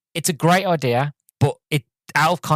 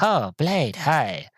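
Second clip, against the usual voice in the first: lightly mimicked nervous vampire; 3 semitones higher, much slower than usual. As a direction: put on a hint of an awkward vampire. Imitates the vampire greeting Blade.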